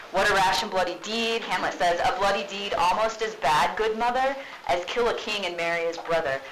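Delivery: loud declaiming of the play; dramatic reading. Acted. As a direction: read it out like on stage. Reading Hamlet aloud loudly.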